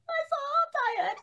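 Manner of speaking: exhausted tears spilling into the words; laughing crying. Real, not acted. Telling her co-host she's exhausted, near tears. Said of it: "I don't know if I'm crying or if I'm laughing crying".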